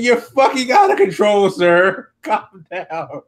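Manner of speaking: high, loud mock reprimand easing off; mock exasperation. Host answers the yelling wrestler, mock exasperated reprimand. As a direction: act out telling off an unruly man.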